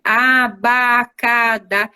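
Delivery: Abacadá drawn out slowly, syllable by syllable; slow reading aloud. Shown as a demonstration. Teacher showing how she reads to children, slow. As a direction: read it slowly, as to a class. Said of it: "Vamos ler aqui".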